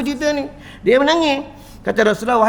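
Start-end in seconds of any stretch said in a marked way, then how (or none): none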